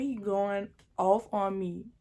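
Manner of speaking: usual speaking voice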